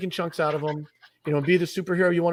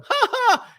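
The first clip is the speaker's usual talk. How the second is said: high, loud mock laugh at a crazy idea; mocking audience laugh; 5 dB louder than usual. The audience scoffing at Bezos with a high mock laugh. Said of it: "They're like".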